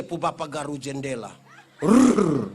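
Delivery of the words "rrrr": rolled rrr imitating scratching on glass; loud scratching rasp. Father scratching the window outside, a loud rolled rasp. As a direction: scrape the window with a rolling rrr.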